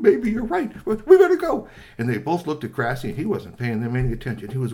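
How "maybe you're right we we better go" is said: much higher, louder panicky voice; frightened troll squealing to run; scared high squeal. Acted. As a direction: panic and bolt. Rocky agrees to flee.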